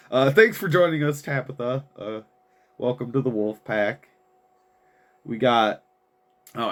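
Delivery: normal talking voice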